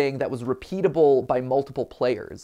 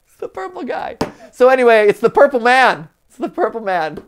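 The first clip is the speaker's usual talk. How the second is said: playful excited; 9 semitones higher, 8 dB louder than usual. Acted. Playful announcement of villain.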